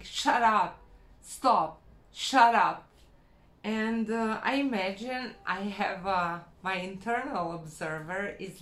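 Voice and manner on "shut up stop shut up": loud, firm orders, each word cut short; stern self-command. Retold or imitated. Telling her own negative thoughts to stop, loud and stern. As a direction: bark it at your own spiralling thoughts. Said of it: "I stop myself la-, by saying".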